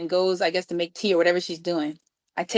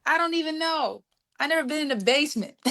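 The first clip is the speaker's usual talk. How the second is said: flustered excuse; 8 semitones higher, 3 dB quieter than usual. Retold or imitated. Girl explains herself, flustered.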